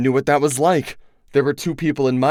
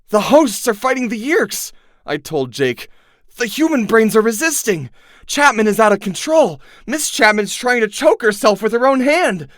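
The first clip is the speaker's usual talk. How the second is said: much higher, excited telling; amazed report; 11 semitones higher, 4 dB louder than usual. Acted. Narrator tells Jake the hosts fight back, amazed. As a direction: rush the news out in wonder.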